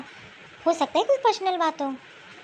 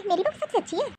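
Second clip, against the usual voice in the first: higher, softer sing-song mimicry of the proud mother-in-law; mocking mother-in-law; 5 semitones higher, 3 dB quieter, faster than usual. Sister-in-law mocks how mother-in-law praises her daughter-in-law, in a high, soft, sneering mimic. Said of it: "बहुत बहू बहू करती है ना कि".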